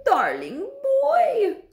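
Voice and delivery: high, slow, sugary witch voice; cooing witch. A narrator's reading. Grand High Witch luring a boy up, cooing. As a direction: coo it high, drawn out and syrupy. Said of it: "cooed the Grand High Witch".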